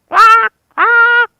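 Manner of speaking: high loud quacking bird calls; bird squawk. Acted. Narrator squawks for the crows being scared off. Crow noises in fight.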